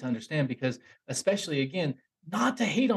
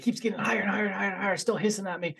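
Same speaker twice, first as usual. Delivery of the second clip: rising panic; 7 semitones higher, faster than usual. A narrator's reading. Creature rising, building tension.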